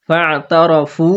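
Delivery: slow ফাতারাফু with the ain from mid-throat; throat letter demo. Shown as a demonstration. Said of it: "গলার মাঝখান থেকে উচ্চারণ করতে হবে". (ফাতারাফু গলার মাঝখান থেকে)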